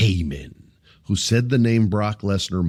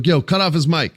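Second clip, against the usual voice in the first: telling him how; 8 semitones higher than usual. Retold or imitated.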